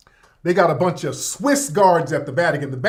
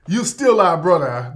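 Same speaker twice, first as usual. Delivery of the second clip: ordinary talk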